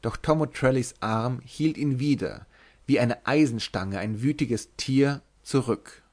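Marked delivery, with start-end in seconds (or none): none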